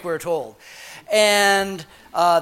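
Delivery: normal talking voice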